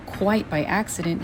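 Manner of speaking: plain reading voice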